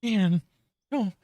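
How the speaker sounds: a confused inner voice, thinking aloud; inner monologue voice. Pretend. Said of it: "You mind my inner lo-, monologue voice?".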